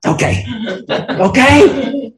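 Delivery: meek rising okay, okay, okay; sheepish okays. Retold or imitated. Husband answers wife with rising sheepish okays. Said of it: "And I said in all my wisdom".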